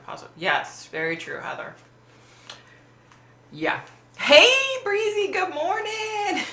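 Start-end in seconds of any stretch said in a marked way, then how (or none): 4.2-6.4 sing-song greeting. Real, not acted. Greeting a viewer in chat.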